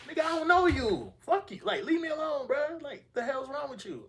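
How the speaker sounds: very high, loud retold outburst; angry telling-off. Storyteller replaying how he told off a pushy stranger, shrieking indignantly. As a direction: yell in outraged squeal, fending someone off.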